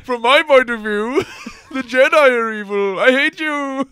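angry crying. Pretend. Quoting Revenge of the Sith.

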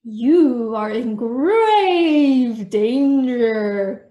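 loud, drawn-out ghostly wail; very slow, eerie mock warning; spooky voice. A narrator's reading. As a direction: stretch every word like a haunting ghost. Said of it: "she said in a spooky voice".

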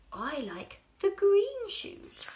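high, loud delighted customer. Acted. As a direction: chirp it happily. Customer voiced in story.

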